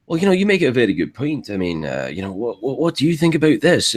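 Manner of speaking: a polite dwarf voice, high in pitch and fast, asking "what do you think about this?"; an agreeable character, lightly voiced; friendly in-character question. Shown as a demonstration. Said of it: "it could also be like".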